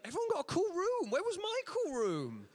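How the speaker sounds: high, slow whiny complaint; mock indignant. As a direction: whine like a left-out kid.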